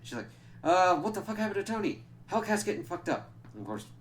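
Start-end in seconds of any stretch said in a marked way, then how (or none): 0.6-3.3 much higher, louder panicked voice; a startled teammate yelping in alarm; Misty seeing Tony vanish, higher and louder in mock panic; alarmed outburst.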